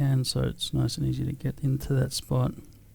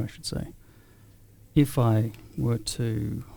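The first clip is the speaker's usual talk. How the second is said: ordinary delivery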